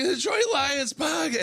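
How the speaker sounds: imitating a drunk fan's high holler; mock drunken cry of recognition; tanked fan. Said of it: "he's tanked".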